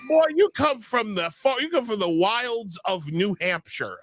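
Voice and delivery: high, hammed-up fake southern preacher accent; mocking a politician's put-on southern preaching voice. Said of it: "fake southern accent where I'm really trying to".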